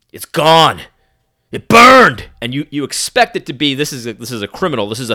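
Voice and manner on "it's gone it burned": loud gruff street tough; tough thug voice. Retold or imitated. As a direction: play a menacing stranger. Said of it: "he's dressed like a thug".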